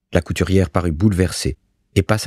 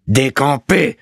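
high, drawn-out command; insistent repeated order; 8 semitones higher, slower than usual. A narrator's reading. Bailiff repeating his order to the stunned landlord, raised and insistent. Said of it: "répéta Malicorne".

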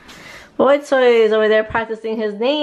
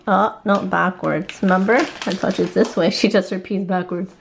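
ordinary talk

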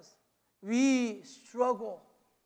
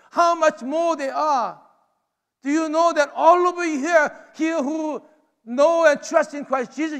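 raised, emphatic preacher's voice; impassioned preaching; 4 semitones higher, 6 dB louder than usual. Real, not acted. Preacher telling the congregation who they are in God's kingdom, impassioned. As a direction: preach it with mounting force.

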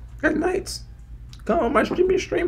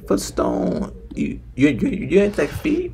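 put-on deep voice; 13 semitones lower, 4 dB quieter than usual. Stranger teases the streamer for his fake deep voice. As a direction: voice pushed much lower, a little forced. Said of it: "I can hear your voice going deep".

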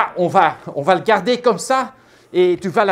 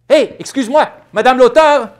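calling out; 5 semitones higher, 8 dB louder than usual. Acted. Calls out to the author.

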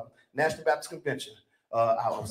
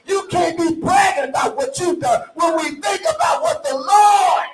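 loud, higher shouting preacher; preaching climax; 7 semitones higher, 7 dB louder than usual. Acted. Preacher urges the church not to brag, full shouting climax. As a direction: hit the climax at full force.

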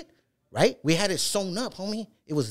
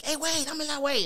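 imitating mad wrestler; 12 semitones higher than usual. Mad wrestler directing his helper, retold high-pitched annoyance. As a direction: retell his annoyed call in a raised pitch. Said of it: "Like, he's mad though".

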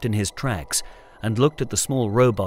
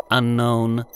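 robot voice; 4 dB louder than usual. Acted. Robot Zeta answers.

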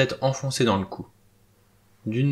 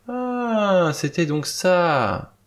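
long, high, drawn-out "aah" of discovery; satisfied exclamation; 8 semitones higher, much slower than usual. A narrator's reading. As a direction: exclaim slowly, pleased at the find. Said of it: "poussa aussitôt une exclamation satisfaite".

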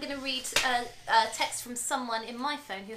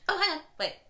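ordinary talk, nothing marked

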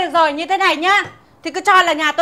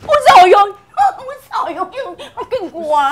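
mock horror; 5 semitones higher than usual. Mock alarm at threat.

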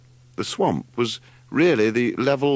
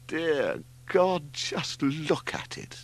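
high, drawn-out moan of dismay; 8 semitones higher, much slower than usual. A narrator's reading. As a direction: moan it slowly in anguish. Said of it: "moaned Leslie".

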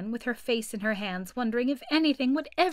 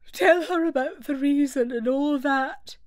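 slow, drowsy turtle voice, higher; sleepy Mock Turtle; 5 semitones higher, 5 dB louder than usual. A narrator's reading. Mock Turtle, half asleep, telling the Gryphon to explain. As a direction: lazily hand the telling over to the Gryphon.

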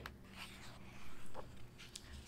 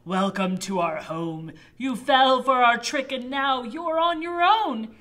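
loud monster voice; 4 semitones higher, 11 dB louder than usual. Acted. Nasties trap the knight.

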